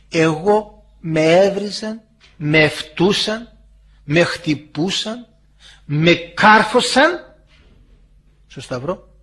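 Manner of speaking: very slow, spaced-out list; solemn recital. Acted. Christ recounts his suffering to the believer, slow and solemn. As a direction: give each verb its own weight, pausing between.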